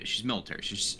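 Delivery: ordinary delivery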